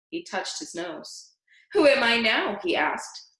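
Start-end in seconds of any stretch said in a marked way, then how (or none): 1.7-2.6 put-on higher, louder stolen girl's voice; mock the girl with her own voice; Leslie's voice. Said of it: "It was Leslie's voice".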